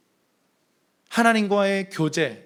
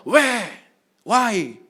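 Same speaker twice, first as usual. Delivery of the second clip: rising, louder protest of "왜"; protesting prophet; 5 semitones higher, 4 dB louder, slower than usual. Acted. Habakkuk protesting to God, higher and louder. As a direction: cry out why at God. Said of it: "항의하는 선지자의 모습입니다".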